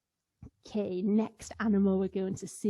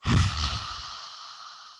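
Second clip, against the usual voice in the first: forceful breathy roar exhale; lion's breath exhale. Shown as a demonstration. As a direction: huff out hard like a lion. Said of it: "So I'll show you".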